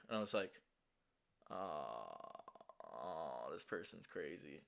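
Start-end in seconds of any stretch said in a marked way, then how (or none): 1.5-4.6 low, quiet, under the breath; hushed inner thought. Retold or imitated. Realizing the roommate is crazy, said low and quiet. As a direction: mutter the thought to yourself.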